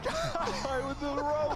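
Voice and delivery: ordinary talk, nothing marked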